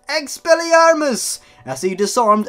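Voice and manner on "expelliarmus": high, loud screamed spell; screaming. Acted. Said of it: "as Amerio screamed".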